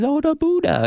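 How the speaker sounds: high-pitched, badly imitated Southern accent; terrible Southern accent. Advisor imitating the prisoner he visits, terrible Southern accent. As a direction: put on a bad Southern woman's accent, way up high. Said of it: "that's my terrible Southern accent".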